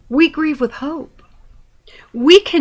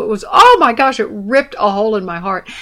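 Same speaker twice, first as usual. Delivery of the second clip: ordinary talk, nothing marked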